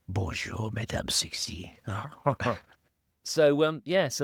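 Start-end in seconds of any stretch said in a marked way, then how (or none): 0.1-1.8 put-on whispered sleazy French old man; breathy lecherous French whisper; leering old lecher. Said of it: "the whole Albert Galoche". 1.8-2.6 laugh. Real, not acted. Laughs after own impression.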